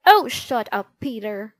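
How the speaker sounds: loud irritated snarl. A narrator's reading. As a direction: snap it at your little brother. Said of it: "snarled Henry".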